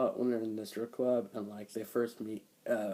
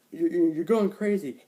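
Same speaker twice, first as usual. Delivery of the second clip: loud, higher mock shout; retold shout; 6 semitones higher, 12 dB louder than usual. Joe shouting at his wife through the door, loud. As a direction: hammer the stutter as a shout. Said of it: "He's like".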